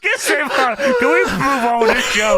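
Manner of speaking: high, exasperated begging to move the show on; half-laughing cry to get on with it; exasperated plea. Real, not acted. Fed-up host to the show, high exasperated plea.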